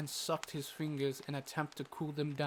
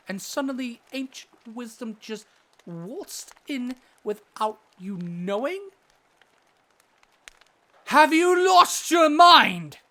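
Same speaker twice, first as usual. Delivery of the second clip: blowing up; 9 semitones higher, 13 dB louder than usual. Acted. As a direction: build from disbelief into a full outburst. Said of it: "I usually don't blow up as I did".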